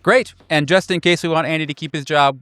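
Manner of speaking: normal talking voice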